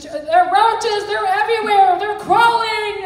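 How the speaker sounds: grandma imitating a terrified granddaughter; retold panicked child. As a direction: imitate a scared child's screaming. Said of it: "and she's screaming".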